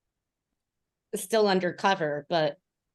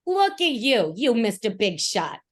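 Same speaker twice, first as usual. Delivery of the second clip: high, loud taunting scorn; mocking berating; 6 semitones higher, 4 dB louder than usual. Bard mocking the riders to stun them, high taunting scorn. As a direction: sneer at them as a show-off. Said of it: "just starts berating them".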